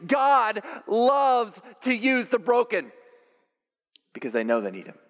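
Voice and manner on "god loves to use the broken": slow, very high, loud shout; preaching shout. Acted. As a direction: proclaim it slowly at full voice. Climax of sermon.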